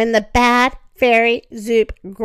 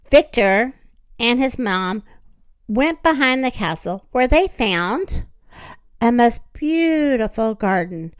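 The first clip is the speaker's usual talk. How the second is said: plain reading voice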